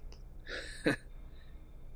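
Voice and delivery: quiet amused chuckle; soft breathy laugh. Acted. Laughs at remark.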